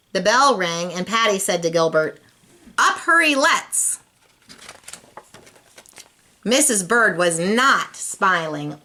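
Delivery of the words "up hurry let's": playful backward. A narrator's reading. Patty mimics backward talk.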